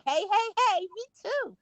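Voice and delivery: high, chirpy hellos; eager greeting back. Retold or imitated. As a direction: re-enact an over-friendly reply. Acts out greeting neighbours back.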